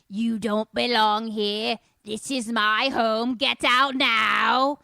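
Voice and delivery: very loud, drawn-out demon roar; hammed-up possessed-child voice; demonic possession voice. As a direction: bellow slowly like a territorial ghost. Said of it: "it has this voice".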